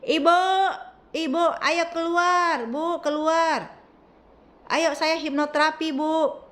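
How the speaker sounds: high, loud pleading through a door; loud calling. Retold or imitated. Therapist calling to her through the bedroom door, loud and high. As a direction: shout to be heard through the door. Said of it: "Ngomongnya keras begitu".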